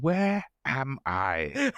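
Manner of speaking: drawn out very slowly, word by word; slow, baffled thought. Retold or imitated. Comic retells his reaction to a road sign, drawn out slowly. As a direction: stretch each word in disbelief.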